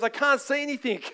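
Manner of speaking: lightly voiced young girl; daughter with glasses on. Sister trying her old glasses after healing, mild complaint. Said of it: "and she goes".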